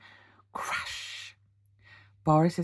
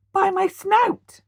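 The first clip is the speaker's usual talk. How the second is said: much higher, louder, slow; frightened cry; 14 semitones higher, 8 dB louder, slower than usual. A narrator's reading. Horace spots the wolf's eyes, crying out in fright. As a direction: gasp it out in fright. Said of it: "cried Horace".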